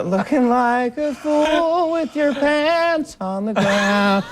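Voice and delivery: put-on very high, slow sad [name] warble; [name] impression. Host sings the [name] take on a novelty hit, high and mournful. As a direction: sing it like a haunting lament. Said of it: "you go".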